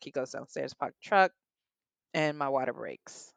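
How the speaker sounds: ordinary talk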